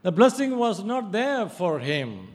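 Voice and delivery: loud, high, impassioned sermon; fiery preaching. Acted. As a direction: drive the point home from the pulpit. Preaching at full force.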